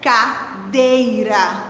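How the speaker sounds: "cadeira" very slow, split as ca, dei-ra; syllable by syllable. Shown as a demonstration. Teacher helps children hear the rhyme, syllable by syllable. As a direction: draw out each syllable of "cadeira". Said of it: "Se eu parar bem pra pensar".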